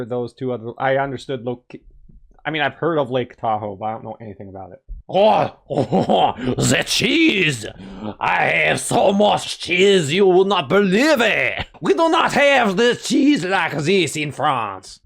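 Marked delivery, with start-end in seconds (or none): 5.1-15.0 hammed-up French accent, slow and rapturous; fake French accent. Player voices the skier raving about cheese, fake French accent. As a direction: gush slowly in an over-the-top French accent. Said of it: "put on a fake French accent".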